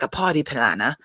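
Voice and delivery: party planner with dropped r's, put-on accent; mock accent, softened r's on party planner; Dorit's accent. Podcaster mimicking a housewife's party boast, put-on accent. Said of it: "Dorit is talking about how".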